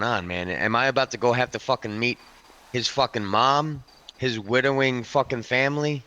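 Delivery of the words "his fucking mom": normal talking voice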